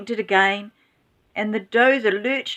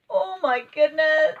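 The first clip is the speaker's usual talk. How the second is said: high, dramatic oh my goodness; dramatic exclamation; 8 semitones higher than usual. A narrator's reading. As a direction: gasp out the refrain in alarm. Refrain after Grandma's fall.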